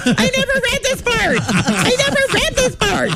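very high, laughing panic; shrieking laughing denial; panicked disbelief. Retold or imitated. Panicking on reading script.

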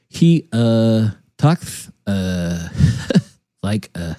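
very low, halting, dopey cartoon mumble; Beavis. Imitating tired brother's speech, low Beavis voice. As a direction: drop way down and stumble between grunted uhs. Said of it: "sounds just like Beavis".

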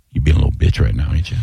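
low, quick needling, lightly acted; retold coach's low-voiced taunt; coach in player's ear. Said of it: "I'd get right up in his ear".

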